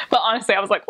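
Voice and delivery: usual speaking voice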